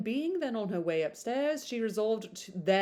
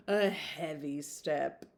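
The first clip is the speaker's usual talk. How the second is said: usual speaking voice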